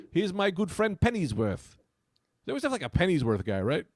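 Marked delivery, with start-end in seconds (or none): 0.1-1.8 high, slow mock British accent. Comic introducing imaginary British friend Pennyworth, British accent. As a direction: present a friend in put-on British. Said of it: "We should do British Rascals".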